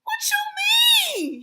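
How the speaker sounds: shrieked high and loud in disbelief; loud, high shocked shriek of disbelief; outraged what. Real, not acted. Outraged reaction.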